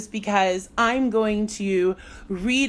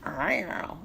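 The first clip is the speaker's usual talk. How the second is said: mock meow; 4 semitones lower, 8 dB quieter than usual. Shown as a demonstration. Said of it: "cats like".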